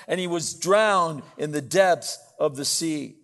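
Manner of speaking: loud, declaimed scripture reading; forceful preaching. Acted. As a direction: proclaim the verse to the whole congregation.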